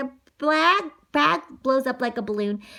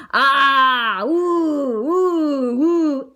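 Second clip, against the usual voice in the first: loud wavering ghostly oohs; ghost wail; 6 dB louder, much slower than usual. Acted. Boy pretends he's a ghost to scare sister, wailing. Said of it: "he's pretending he's a ghost".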